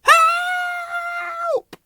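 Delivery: very high, long-held squeal; piercing drawn-out call for rescue; long cry for help. A narrator's reading. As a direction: stretch the word into a wail. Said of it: "called Whimsy".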